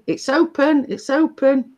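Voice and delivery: high-pitched mock call to the door; retold shout. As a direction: call out as if through the house, without real volume. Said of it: "I'm shouting".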